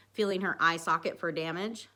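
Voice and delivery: usual speaking voice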